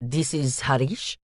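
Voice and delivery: put-on soft Indian accent, statement rising like a question; questioning lilt. Student answering agent's call, uptalk Indian accent. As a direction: answer the phone as if asking. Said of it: "inflection on all of his words that made every statement sound like a question".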